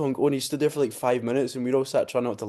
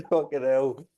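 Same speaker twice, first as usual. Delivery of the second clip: laughing exasperation; 4 semitones higher, slower than usual. Retold or imitated. Laughing retelling.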